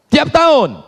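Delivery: high, forceful mock interrogation; penanya tegas. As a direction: demand an answer from the worshipper. Acted questioner interrogates worshipper. (suara tinggi menginterogasi)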